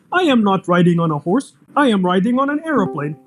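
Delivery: usual speaking voice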